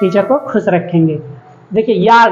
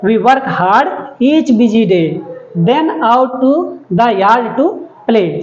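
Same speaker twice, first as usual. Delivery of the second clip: very slow, sing-song read-aloud; slow poem reading; much slower than usual. Shown as a demonstration. Teacher reading the rhyming lines to the class, very slowly. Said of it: "teacher reads poem lines slowly".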